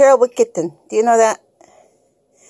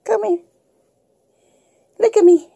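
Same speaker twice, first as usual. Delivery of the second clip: high pet-talk voice; 10 semitones higher than usual. Real, not acted. Coaxing kitten, high voice.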